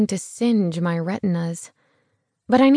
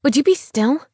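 sharp, higher, louder snap; snapped; 6 semitones higher, 4 dB louder than usual. A narrator's reading. Hungover woman snapping at the man in her bed. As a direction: bite it off, irritated. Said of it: "I snapped".

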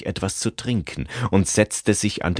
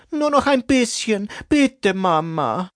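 put-on child begging; 4 dB louder than usual. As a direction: beg like a small kid who wants more time. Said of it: "bettelte das Kind".

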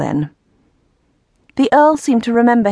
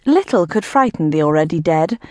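usual speaking voice

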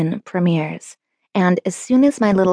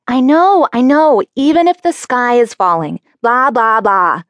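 put-on high, loud eye-rolling teen; exasperated; 5 semitones higher, 6 dB louder than usual. A narrator's reading. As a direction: cut him off, exasperated. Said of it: "I rolled my eyes".

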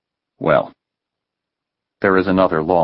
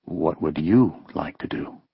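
voice lowering; 2 semitones lower, 6 dB quieter than usual. A narrator's reading. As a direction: drop the voice to a hush. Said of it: "her voice lowering".

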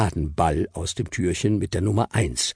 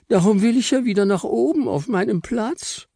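squeaky Frau Stern. Acted. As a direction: plead sweetly in a tiny high voice.

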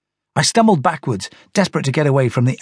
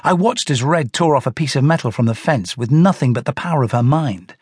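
ordinary delivery

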